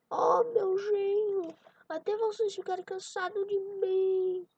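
high-pitched acted mother-in-law. Mother-in-law answers her son-in-law.

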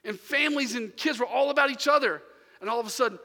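worked-up preacher telling it to the congregation; preaching at full force. Acted. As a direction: preach it with rising passion. Preacher tells the boot camp story.

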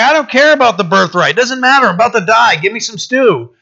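high-pitched, mock dismissive Esau; exaggerated careless brush-off. Mocks Esau's careless birthright trade.

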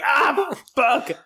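pained yell. Retold or imitated. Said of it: "they're like".

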